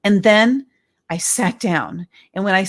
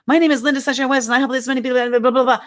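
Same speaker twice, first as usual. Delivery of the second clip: rushed, higher, no breaths; breathless run-on; 6 semitones higher, much faster than usual. Retold or imitated. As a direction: rattle it off without pausing for air. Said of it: "they don't take a breath at all".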